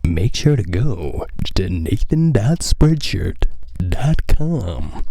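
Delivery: slow, drawn-out radio announcer plug. Shown as a demonstration. Urging listeners to visit his merch site like a radio DJ. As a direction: sell it like a late-night DJ. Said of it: "how every radio D J sounds".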